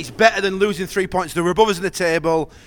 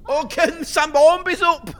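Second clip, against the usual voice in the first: excited cry; 8 semitones higher, 3 dB louder, slower than usual. Real, not acted. Excited at chance.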